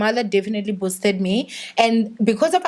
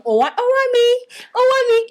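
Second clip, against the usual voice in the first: mom calling fondly; 13 semitones higher than usual. Retold or imitated. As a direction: call her name up high like a loving mom. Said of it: "she would start just saying".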